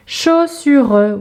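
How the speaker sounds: put-on segmented word for young readers; syllable by syllable. Shown as a demonstration. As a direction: split "Chaussure" into held syllables.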